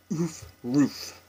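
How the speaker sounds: "Oof, roof" rhymed, short vowel; playful rhyme demo. Shown as a demonstration. Vlogger playfully showing her local vowel, short and soft. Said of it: "So it's like".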